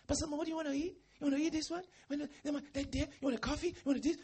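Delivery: lightly voiced eager wife; eager hostess. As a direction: play the overjoyed wife fussing over a guest. Wife eagerly offering food.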